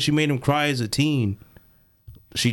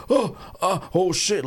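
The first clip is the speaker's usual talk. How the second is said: higher-pitched pained surprise, acted out; mock stabbed reaction; 6 semitones higher than usual. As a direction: play a startled stab victim. Acting out the stabbed guy reacting.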